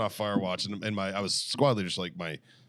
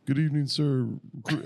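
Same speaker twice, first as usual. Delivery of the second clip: raised, higher-pitched recruit voice; recruit reporting; 6 semitones higher than usual. Acted. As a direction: report formally to the drill instructor. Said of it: "fire watch started reporting his post".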